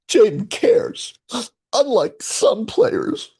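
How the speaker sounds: guilt-trip the missing player theatrically; mock wounded. Mock hurt at absent player.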